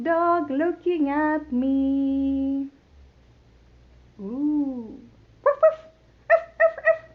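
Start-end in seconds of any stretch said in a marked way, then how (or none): 5.4-7.1 high, loud little dog barks; high yappy barks. Shown as a demonstration. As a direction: yap like a puppy. Dog sound after dog.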